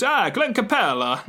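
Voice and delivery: high, hammed-up mock Italian; bad Italian accent. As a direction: badly fake an Italian accent on the name. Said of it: "not even Italian that I'm doing".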